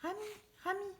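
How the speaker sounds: calling out. A narrator's reading. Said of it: "appelle-t-elle".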